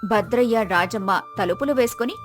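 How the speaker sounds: ordinary delivery, nothing marked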